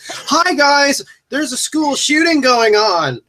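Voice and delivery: high, slow, chirpy announcement; bright chipper voice, slowly; mock cheerful character. Mocking the character's roller-skate scene.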